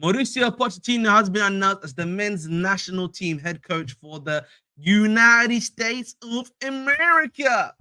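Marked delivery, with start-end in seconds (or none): 4.8-7.7 hammed-up American accent, drawn out and higher; terrible American accent. Streamer announcing the new national team coach, terrible American accent. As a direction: stretch it out in a badly faked American accent. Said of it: "That was a terrible accent".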